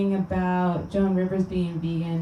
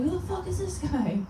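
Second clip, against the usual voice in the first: mock gossip; 10 semitones higher, 3 dB quieter than usual. Meat eaters gossiping about him.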